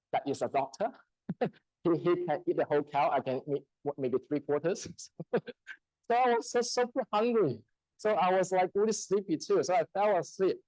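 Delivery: ordinary talk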